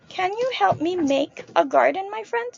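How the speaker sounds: ordinary delivery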